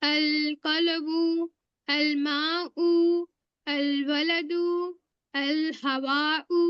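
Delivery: moon letter words drawn out very slowly with a clear l; dictating moon-letter examples slowly; slow modeling. Shown as a demonstration. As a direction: say each अल word slowly, lam sounded. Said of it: "लाम भी पढ़ रही हूँ".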